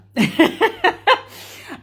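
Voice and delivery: bursts into a high, loud laugh; real laugh. Real, not acted. Laughing at the troll who called her a devil woman, real laugh.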